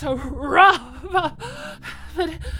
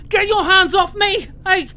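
higher and louder, furious; angry shout; 5 semitones higher, 5 dB louder than usual. Acted. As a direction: yell at the captor to let go. Captive struggles against her captor.

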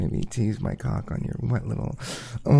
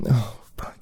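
ordinary delivery

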